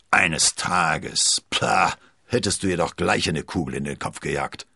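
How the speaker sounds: gruff, huffy grumble; annoyed. A narrator's reading. Porthos grumbling angrily at Athos. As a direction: scoff in irritation. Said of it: "zwirbelte verärgert".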